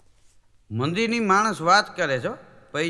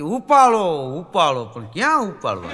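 loud ringtone chant of ઉપાડો; 6 dB louder than usual. Retold or imitated. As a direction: mimic a ringtone calling to pick up. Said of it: "અમુક અમુક નથી રિંગુ વાગતી". (Ringtone જેવું મોટેથી "ઉપાડો")

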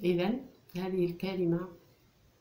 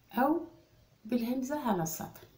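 normal talking voice

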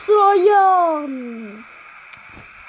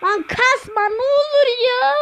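pleading shout; 6 semitones higher than usual. Real, not acted. Pleading with the game.

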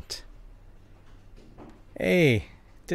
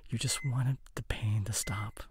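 hushed, gentle whisper; 8 dB quieter than usual. Acted. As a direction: breathe the words softly. Comforting partner in hospital.

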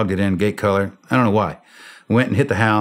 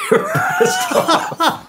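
laughed out slowly through the words; laughing; much slower than usual. Real, not acted. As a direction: crack up as you say it, dragging it out. Laughing while telling punchline.

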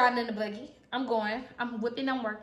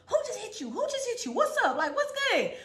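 confrontational friend; 8 semitones higher than usual. Retold or imitated. Friend looks for the attacker.

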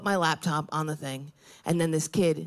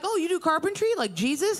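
people asking, high mock voice; 9 semitones higher than usual. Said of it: "they're like".

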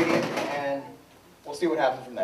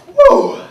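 cheer whoop; 12 dB louder than usual. Acted. Host cheers Donald's arrival.

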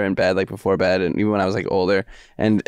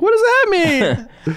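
put-on high, slow childlike wonder; confused little kid; much slower than usual. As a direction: ask it like a baffled small child. Little kid confused by mom's book.

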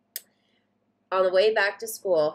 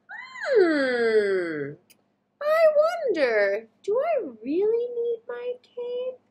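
put-on much higher, slow musing kid voice; wondering child; much slower than usual. Satya wondering if she needs her cape, slow and high. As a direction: puzzle it out aloud.

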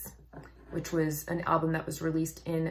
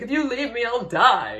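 higher, louder melodramatic lover's plea; desperate lover; 7 semitones higher, 9 dB louder than usual. Retold or imitated. Explaining the song's crazy love, she mock-pleads as the lover. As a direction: ham up a lovesick threat.

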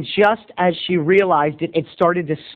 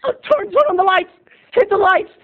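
much higher, fretting oh no; mock panic; 13 semitones higher than usual. Teacher waking to a sex scene, high flustered panic. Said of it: "she's like".

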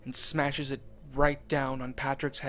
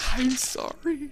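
high, drawn-out, anguished cry; mild acted scream, high and slow; screamed apology; 11 semitones higher, slower than usual. A narrator's reading. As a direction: stretch the apology out, voice pitched way up. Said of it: "all the while screaming".

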